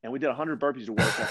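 ordinary talk, nothing marked

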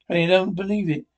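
normal talking voice

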